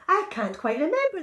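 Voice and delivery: high, loud puzzled Gruffalo; big Gruffalo voice. Acted. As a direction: boom out a muddled answer. Gruffalo answers his child.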